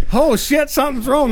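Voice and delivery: startled. Retold or imitated. Retelling a surprise at the hotel room.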